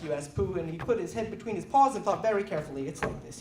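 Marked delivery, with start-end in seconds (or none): 2.9-3.4 whisper. A narrator's reading. Pooh confides quietly.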